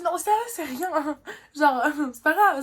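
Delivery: nervous laugh through the words. Retold or imitated. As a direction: pretend it's fine, laughing. Laughing off being ghosted.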